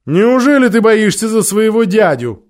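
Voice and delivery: loud, high mocking queen; evil queen. A narrator's reading. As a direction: taunt a frightened boy. Queen mocks Digory.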